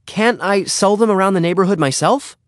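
put-on high-pitched kid asking permission; pleading boy. A narrator's reading. Boy pleading with his father.

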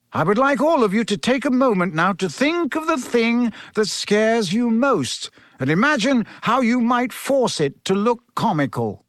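high-pitched, measured teacher addressing pupils; Professor Lupin. Acted. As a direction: instruct the whole class, stretching the key words.

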